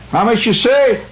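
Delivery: gas station attendant. Retold or imitated. Southern attendant asking the driver, in a high mock voice. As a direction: squeak it like a puzzled Southern pump guy. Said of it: "so he says".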